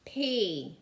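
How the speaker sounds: ordinary delivery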